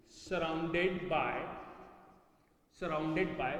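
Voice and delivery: "surrounded by" stretched very slowly; slow dictation. Shown as a demonstration. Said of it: "it is".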